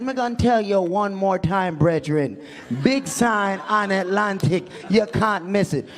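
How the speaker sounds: insistent put-on Jamaican patois; exasperated imitation Jamaican; emphatic Jamaican accent. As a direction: spell it out firmly in a Jamaican accent. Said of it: "messing with some Jamaicans".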